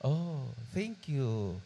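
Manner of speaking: slow, soft and touched; drawn out gently, moved by the card; softened voice. Retold or imitated. Employer softly thanking the maid, slow and touched. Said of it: "Lumambot ang boses".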